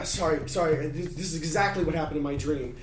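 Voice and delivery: normal talking voice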